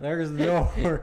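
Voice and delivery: stern boss. Pretend. Mock firing, pointing out.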